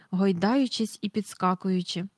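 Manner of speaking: ordinary delivery, nothing marked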